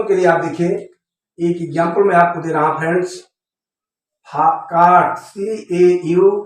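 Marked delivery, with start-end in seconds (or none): none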